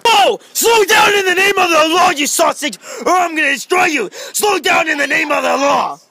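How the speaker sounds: loud high cop shouting; mock cop shout. Kid plays cop shouting at a driver, loud and high. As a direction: bark orders at the speeder.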